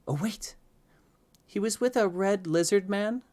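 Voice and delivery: put-on high child voice rising into a question; hopeful child. A narrator's reading. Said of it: "You're starting to get scared".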